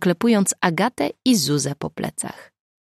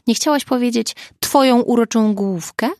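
higher, louder mocking question; sarcastic; 6 semitones higher, 5 dB louder than usual. A narrator's reading. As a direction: needle her with pointed mock sweetness. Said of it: "zapytał z przekąsem". (z przekąsem, drwiąco)

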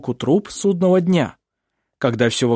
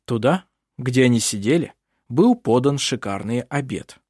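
ordinary delivery, nothing marked

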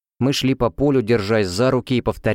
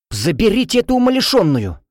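much higher, loud exasperated yell; shout; 11 semitones higher, 4 dB louder than usual. Acted. Roommate shouting about the madwoman, exasperated. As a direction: yell for someone to take her away. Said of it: "крик Хелены".

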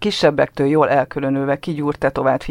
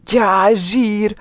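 put-on excited boy; 7 semitones higher, 4 dB louder than usual. A narrator's reading. Boy delighted.

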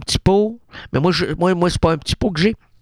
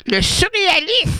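daughter's high voice; 12 semitones higher than usual. Retold or imitated. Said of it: "Elle dit, «Maman".

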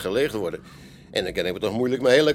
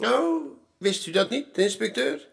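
mock surprise; 12 semitones higher than usual. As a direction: act amazed that he doesn't know. Barman surprised inspector doesn't know. (gespeelde verbazing, hoger en trager)

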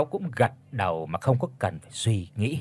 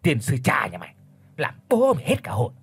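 cursing outburst. Acted. Old Chánh curses the chief for startling him, heated. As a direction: lash out after a scare. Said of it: "chửi đồng cả lên".

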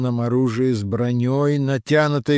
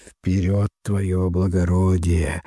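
serious; 6 semitones lower than usual. A narrator's reading. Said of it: "серьёзно ответил инквизитор".

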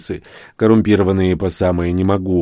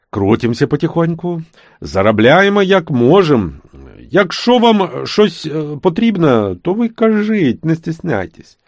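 put-on Surzhyk accent; 7 semitones higher, 4 dB louder than usual. Trader fawning, Surzhyk.

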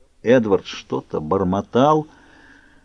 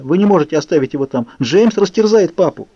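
panicked shout; 7 semitones higher, 3 dB louder than usual. A narrator's reading. Bella keeps screaming about Charlie.

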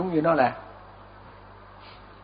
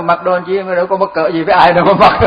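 louder toward the end, breaking into laughter; amused laughing; 3 semitones higher, 3 dB louder than usual. Real, not acted. Teacher joking to students about a naked hermit, laughing. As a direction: let real laughter take over the words.

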